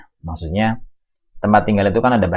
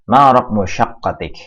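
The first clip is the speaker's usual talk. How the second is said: "ma raqmu syaqqatik" said louder, stress on "ma"; emphatic model question; 3 semitones higher, 5 dB louder than usual. Shown as a demonstration. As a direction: announce "ma raqmu syaqqatik" boldly. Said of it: "teacher reads the example question".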